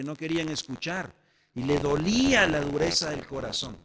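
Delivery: loud, insistent pulpit delivery; fervent preaching. Acted. Preacher urging his congregation, full-voiced and fervent. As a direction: drive the point home to the church.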